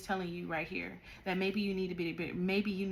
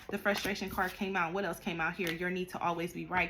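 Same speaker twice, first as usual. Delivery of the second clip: ordinary talk, nothing marked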